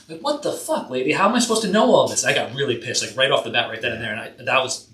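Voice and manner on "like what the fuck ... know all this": exasperated outburst. Real, not acted. Exasperated outburst about client.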